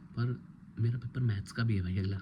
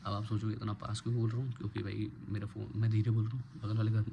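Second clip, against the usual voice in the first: normal talking voice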